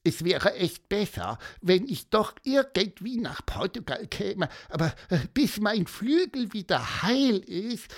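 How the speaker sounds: high peeping bird voice, a touch sad; squeaky cartoon voice. Gonzo the bird speaks.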